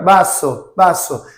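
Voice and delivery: vaso said as baso; Spanish vaso. Shown as a demonstration. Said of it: "Não é um B".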